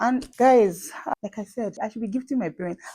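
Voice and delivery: ordinary delivery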